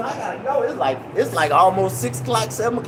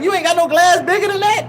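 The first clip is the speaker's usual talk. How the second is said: high mock woman; 11 semitones higher, 5 dB louder than usual. Woman mocking small glasses.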